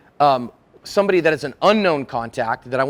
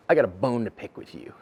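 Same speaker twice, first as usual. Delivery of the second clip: low, softer confrontational opener; quietly menacing, pitched down; confrontational complainer; 7 semitones lower, 6 dB quieter than usual. Acted. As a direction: come in looking to pick a quarrel. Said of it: "somebody comes in and goes".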